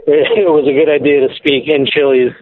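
distant caller on speakerphone; phone voice. Real, not acted. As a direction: talk from a car through a phone.

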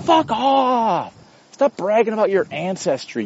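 high, drawn-out exasperated retort. Acted. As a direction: stretch it out high, fed up. Exasperated retort to boastful Americans.

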